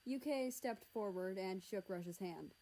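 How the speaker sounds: plain reading voice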